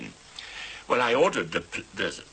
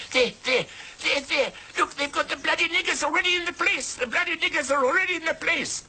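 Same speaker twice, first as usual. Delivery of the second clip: high, loud outraged shriek; shrill woman yelling; 4 dB louder than usual. Retold or imitated. Settler man mimicking a South African woman yelling at the crowd. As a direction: screech it like a furious settler woman. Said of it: "I remember one South African woman saying".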